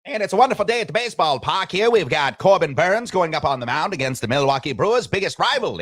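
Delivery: put-on high, loud old-timey radio announcer; 1920s sportscaster voice. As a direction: boom it out like an old radio man. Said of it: "nineteen twenty sportscaster's voice".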